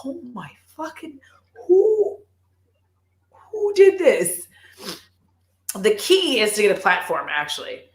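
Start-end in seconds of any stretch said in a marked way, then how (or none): 0.0-4.5 high, startled squeal of disbelief; stunned by a perfect heel; shocked exclamation. Retold or imitated. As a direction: gasp it out, then shriek the question high. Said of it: "and I'm like".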